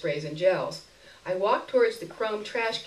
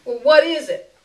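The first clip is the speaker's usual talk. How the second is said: higher, louder, laughing a little; a wary laugh behind the question; suspicious beggar woman; 8 semitones higher, 11 dB louder than usual. A narrator's reading. Beggar woman to narrator, laughing suspicious question. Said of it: "she asked".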